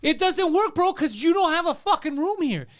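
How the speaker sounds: high, loud taunt; acted-out hallway tirade; retold angry accusation.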